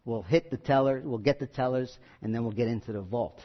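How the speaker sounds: low, soft mob whisper-talk; quiet gangster plan, fading; overheard gangster. Retold or imitated. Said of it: "heard him say".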